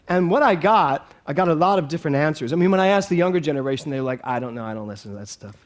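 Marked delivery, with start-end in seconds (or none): 4.2-5.6 dismissive. Retold or imitated. Younger generation's dismissive answer, retold.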